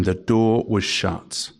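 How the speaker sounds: drawn out slowly, each word weighed; slow, weighty quote. Shown as a demonstration. Preacher quoting verse ten to the congregation, slow and weighty. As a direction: let it land slow and heavy. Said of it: "The last four words of verse ten".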